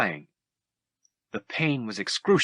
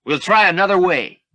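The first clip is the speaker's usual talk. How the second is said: higher, louder call over the din; calling out over battle; 9 semitones higher, 8 dB louder than usual. Acted. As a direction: shout it over the noise of battle. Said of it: "Lando answered over the din".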